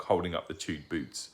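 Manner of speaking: plain reading voice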